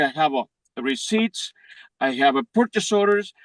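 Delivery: normal talking voice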